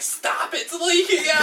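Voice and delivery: high, laughing mock shriek; mock girlfriend. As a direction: squeal it like a protesting girlfriend. Voicing girlfriend's protest.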